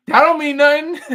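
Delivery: high, loud joking protest; teasing mock comeback. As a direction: toss it out as a hammed-up tease. Jokey flirt with Holly, then just kidding.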